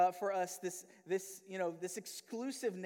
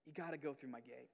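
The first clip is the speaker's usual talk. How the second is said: hushed Jesus; 7 semitones lower, 10 dB quieter than usual. Retold or imitated. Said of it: "Jesus is in here and saying".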